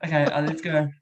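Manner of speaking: normal talking voice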